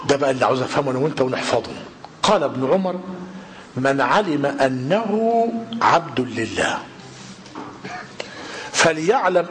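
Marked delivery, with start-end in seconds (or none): none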